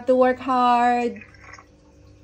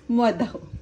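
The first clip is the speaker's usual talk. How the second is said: ordinary talk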